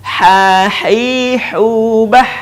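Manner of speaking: "Ha, hi, hu, bah" chanted with long vowels and throaty h; swung syllables. Shown as a demonstration. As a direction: swing smoothly through "Ha, hi, hu, bah". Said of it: "gabungkan dengan ayu-ayun". ("Ha, hi, hu, bah" diayun bersambung)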